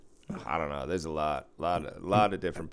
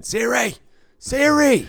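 high, loud exasperated scream; retold screaming; 12 dB louder, slower than usual. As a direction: scream at a stubborn voice assistant. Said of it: "I was screaming at one of the".

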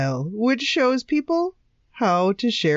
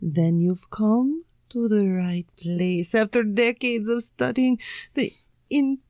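put-on ad pitch in a dodgy Swedish accent; attempted Swedish accent. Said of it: "This is your best Swedish accent".

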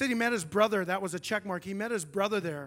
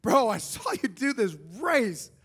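eager greeting; 5 semitones higher, 4 dB louder than usual. Retold or imitated. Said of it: "He's like".

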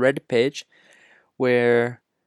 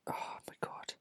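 exasperated whisper; 20 dB quieter than usual. Real, not acted. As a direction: breathe it out in disbelief. Exasperated aside about Dodd.

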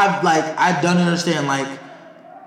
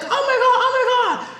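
very high squealing oh my God; hammed-up horrified squeal; mock shriek; 3 dB louder than usual. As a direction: squeal it like a scandalized old lady. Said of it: "she's just like".

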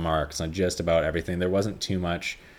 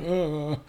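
dying groan; 10 semitones higher than usual. Retold or imitated. Dad dying sound.

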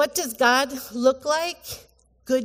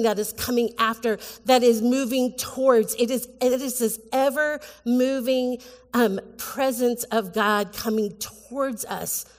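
ordinary delivery

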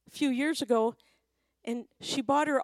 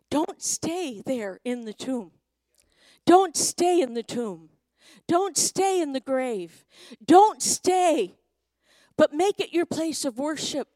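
rousing sermon climax, building louder and higher; impassioned preaching; 5 semitones higher, 6 dB louder than usual. Real, not acted. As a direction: exhort the congregation with mounting force. Preaches exhortation at full force.

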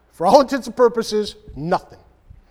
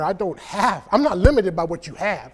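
ordinary talk

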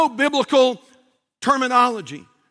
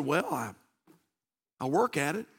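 low, much softer hesitant answer; modest reply; 11 semitones lower, 11 dB quieter than usual. Acted. Airplane passenger asked how well he knows the Bible, modest low reply. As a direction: drop right down, understate it.

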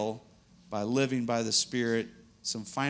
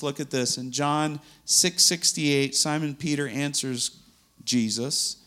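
ordinary talk

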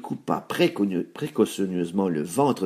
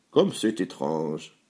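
lightly voiced low, slow musing; a hint of a puzzled woodcutter; woodcutter thinking; 5 semitones lower, slower than usual. A narrator's reading. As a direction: muse slowly and a bit low. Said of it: "pensa-t-il".

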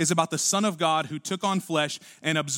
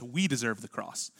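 soft, lower "We deserve the cross"; quieter, blunted "We", falling at the end; softened collective; 7 semitones lower, 7 dB quieter than usual. Acted. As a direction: say "We" low and let it drop away. Contrasts 'we' with pointed 'you'.

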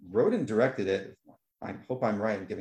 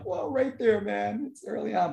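higher, wowed exclamation; impressed viewer; 10 semitones higher than usual. Retold or imitated. Viewer amazed at the early emotional scene, wowed and high. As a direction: react like you caught a great moment. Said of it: "And I'm like".

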